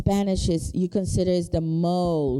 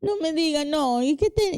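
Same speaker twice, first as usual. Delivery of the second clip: mock Colombian accent, higher and lilting; Colombian twang; 7 semitones higher than usual. Friend imitating Colombian talk, in a light mock Colombian lilt. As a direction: swing it like a Colombian speaker. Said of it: "with that little twang".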